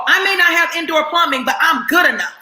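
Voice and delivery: loud, high mock insistence, nearly shouting; defiant self-talk. Proving to the world she's good enough, shouted high. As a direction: belt it out like you're proving yourself to everyone. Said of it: "you're busy trying to convince the world".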